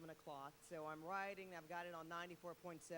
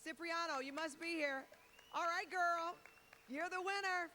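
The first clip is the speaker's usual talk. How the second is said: excited announcement; 7 semitones higher, 5 dB louder, slower than usual. Real, not acted. Announcing the contest winner.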